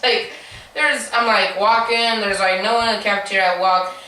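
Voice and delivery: normal talking voice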